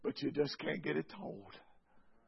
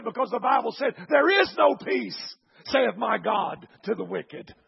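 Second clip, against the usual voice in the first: preached quote; 5 semitones higher, 12 dB louder than usual. Retold or imitated. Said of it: "Adrian looked at him and said".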